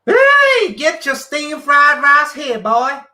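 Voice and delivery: high, loud, slow mock American; American accent. Shown as a demonstration. Showing Monica his American accent, hollered. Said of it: "My American accent".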